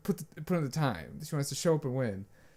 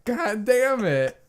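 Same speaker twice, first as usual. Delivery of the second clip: loud, high, slow sarcastic tirade; hammed-up outrage, dragging the words out; indignant rant; 8 semitones higher, 10 dB louder, slower than usual. Telling her no comfort next time, loud and indignant.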